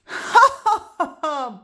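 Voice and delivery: a short scornful burst of laughter; mocking laugh. Buster laughing at the girl for show and tell. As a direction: laugh at the silly idea. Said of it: "Buster laughed".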